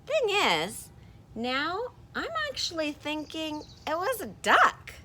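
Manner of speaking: a high character voice with a touch of a whine. Acted. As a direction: voice the puppet-like character, lightly pleading. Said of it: "And this one says".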